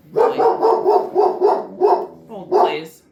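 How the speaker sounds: loud call away from the mic; turning away from phone. Real, not acted. Dog barking, she calls out.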